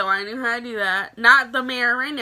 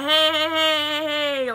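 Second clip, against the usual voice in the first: higher, slow mock call of "hey"; imitating security guards; 4 semitones higher, slower than usual. Security staff breaking up the school fight, mock calling out. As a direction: play a guard stepping in.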